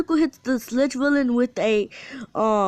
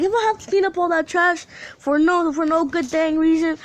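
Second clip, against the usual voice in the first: higher-pitched, lightly acted complaint; mock grumbling; 7 semitones higher than usual. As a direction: complain about a pointless chore, pitched up. Character complains about cleaning trash.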